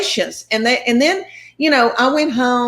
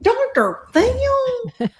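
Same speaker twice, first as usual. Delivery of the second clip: drawn-out, very high squeal of disbelief; much slower than usual. Retold or imitated. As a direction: stretch it slowly, way up high, stunned. I was like.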